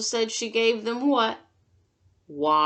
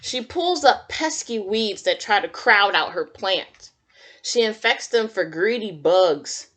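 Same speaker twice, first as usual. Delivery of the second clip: put-on reading to children; 5 dB louder than usual. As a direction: read it like storytime for little kids. Reads picture book to children.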